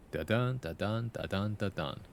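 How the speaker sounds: "da dun" with the weight on dun; iambic beat. Shown as a demonstration. Streamer showing chat the iambic beat, sung rhythm. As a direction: tap out "Da dun" light then strong. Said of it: "I write in iambic?".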